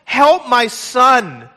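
high, slow weeping cry for help; weeping plea. Acted. Father weeping before Jesus, begging high and slow. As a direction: break down, begging for your son.

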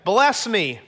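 eager, cheery greeting. Acted. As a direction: cheerfully announce yourself, pitch lifted. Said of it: "He's really cheery".

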